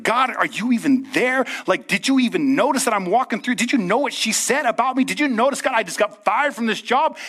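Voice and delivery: high, pleading questions to God; a hint of hurt, aggrieved prayer; desperate complaint to God. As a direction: ask God if he even noticed. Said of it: "And you're like".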